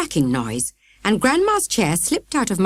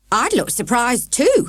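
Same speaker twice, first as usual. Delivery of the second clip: snap; 6 semitones higher, 3 dB louder than usual. A narrator's reading. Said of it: "snapped Grandma".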